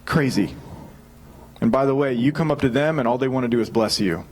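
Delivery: normal talking voice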